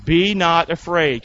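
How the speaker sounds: slow, loud, drawn-out recitation of scripture; slow group recitation. Shown as a demonstration. Preacher leading the church in scripture, slow and loud. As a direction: lead the congregation word by word, slowly. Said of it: "Say this part with me".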